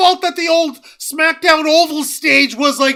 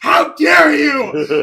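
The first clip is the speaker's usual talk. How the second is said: mock outrage; 2 semitones higher, much slower than usual. Repeated outraged line.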